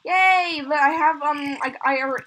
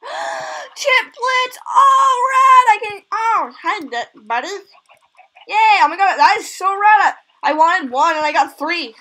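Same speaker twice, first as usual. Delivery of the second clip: high, loud squeals of delight; overjoyed shouting; 8 semitones higher, 9 dB louder, slower than usual. Real, not acted. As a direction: shout it out, beside yourself with joy. Rare chicks hatched.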